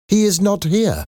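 very high-pitched landlady voice. A narrator's reading. Landlady greeting a visitor, high-pitched. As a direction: offer help in a high, lifted voice.

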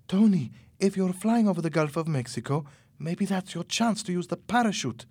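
high-pitched, eager suggestion; excitedly. A narrator's reading. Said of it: "Carmen said excitedly".

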